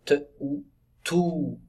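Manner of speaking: "te" and "ou" said apart, then joined into a long "tout"; sound blending. Shown as a demonstration. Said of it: "ça fait ou".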